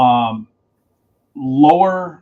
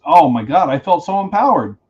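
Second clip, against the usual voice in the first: ordinary delivery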